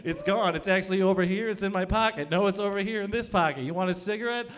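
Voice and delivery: frenzied showman patter, pitched high; acted magician on a roll, climbing in pitch; magic flow state. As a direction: rattle off tricks, building to giddy triumph. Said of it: "I am in magic flow state, baby".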